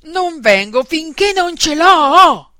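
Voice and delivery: put-on loud, high, stubborn child's yell; roared refusal. A narrator's reading. Little girl refusing to come to table, defiant roar. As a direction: roar back, stretching the last word. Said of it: "ruggì la bambina".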